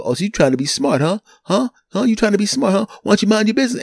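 rising, high-pitched challenge; acted street-tough aggression; mock confrontational. Imagined street dudes challenge him.

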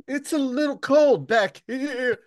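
high, slow whiny complaint; a hint of a pitiful sick boy; mock sick boy. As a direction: whine it like a feverish kid. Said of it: "He's like".

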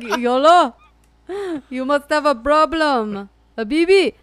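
a hint of an Arabic accent, hammed up; mock Arabic accent. Joking that Bundy sounds Arabic, mock Arabic accent. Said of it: "what if he was Arabic".

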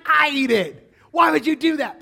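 high, loud exasperated shout; hammed-up outrage at a petty loss; flipping out. Dad protesting to his daughter about the jelly, flipping out. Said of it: "you flip out".

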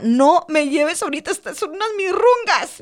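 a touch of panicked pleading. Retold or imitated. Retold desperate prayer for children.

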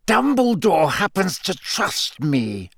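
through clenched teeth. A narrator's reading. Snape insisting on Dumbledore's trust, through clenched teeth. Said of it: "said Snape through clenched teeth".

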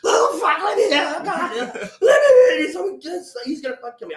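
loud, high screaming plea; acted panic. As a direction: re-enact begging at a locked door. Beating on door in acted panic.

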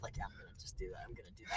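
fast whispered, barely audible; whispered aside. Real, not acted. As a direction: whisper it to yourself quickly. Very soft aside.

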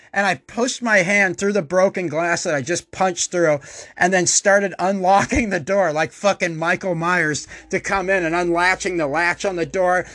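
ordinary delivery

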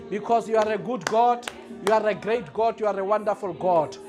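normal talking voice

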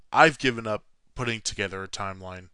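ordinary talk, nothing marked

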